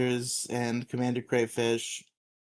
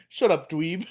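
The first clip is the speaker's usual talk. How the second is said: swooping, higher mock-posh gentleman; 4 semitones higher than usual. Skull turns on Tommy, posh gentleman voice. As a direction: put on a snooty posh gentleman voice. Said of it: "his posh gentleman voice".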